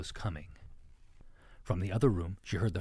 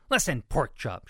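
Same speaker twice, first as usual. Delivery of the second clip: raised, louder, testy retort; snapping; 5 semitones higher, 7 dB louder than usual. A narrator's reading. Ruby corrects the littlest pig, snapping. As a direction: snap it at a big pig. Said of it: "she snapped".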